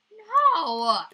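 high, loud cry of dismay. Real, not acted. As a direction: yell no as it slips away. Game loss outcry.

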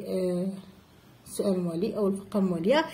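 ordinary talk, nothing marked

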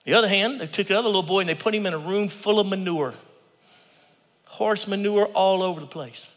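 ordinary talk

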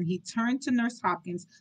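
ordinary delivery, nothing marked

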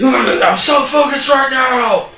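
slow, loud, drugged-up yell; overjoyed high yell of a junkie; wired shout. Retold or imitated. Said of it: "Just shoots up".

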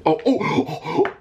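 very high laughing shrieks; panicked laughing yelps. Real, not acted. Teammate caught, panic laughing.

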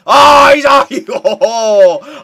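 high, loud cry of shock; startled outcry. Real, not acted. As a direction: burst out in alarm at the play. Startled by the opponent's play.

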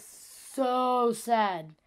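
SO stretched very long before "sad"; drawn-out emphasis. Retold or imitated. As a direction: draw out "so" slowly for effect. Recalls losing the streak.

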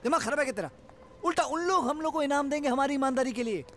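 high-pitched irritated telling-off; exasperated scolding. Acted. Man scolding partner's upside-down thinking, exasperated. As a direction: scold him as if he were an idiot.

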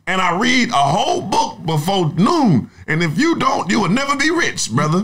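mock guru boasting about his routine; mock hustle guru. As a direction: play a preachy grindset influencer lecturing a slacker. Guru parody continues.

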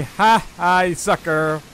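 ordinary delivery

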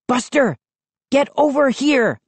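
begging. A narrator's reading. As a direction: coax the dog over. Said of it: "I begged".

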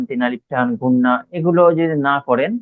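normal talking voice

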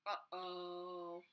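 ordinary delivery, nothing marked

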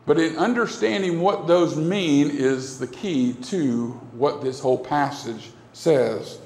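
ordinary talk

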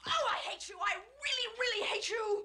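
high-pitched, heated hurt; bitter outburst. Acted. Wife lashes out at husband mid-quarrel, bitterly angry. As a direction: fling the hatred at your spouse.

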